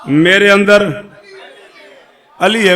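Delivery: slow, loud proclamation; loud proclaiming. Real, not acted. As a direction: ring out the punchline. Climactic repeat, crowd shout.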